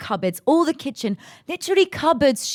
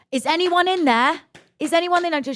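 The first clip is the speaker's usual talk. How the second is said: high, raised imitation of calling through a door; urgent calling out; 11 semitones higher than usual. Sister banging on the bathroom door, high mock call. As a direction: mimic her demanding an answer, pitched up.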